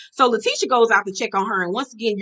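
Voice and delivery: usual speaking voice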